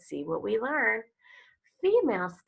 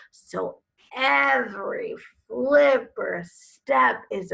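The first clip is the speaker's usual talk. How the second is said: louder, expressive reading of a turtle picture book; storybook read-aloud; 7 dB louder, slower than usual. Acted. Teacher reading a turtle book to her class, expressive storytelling. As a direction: read it like a nature story for kids.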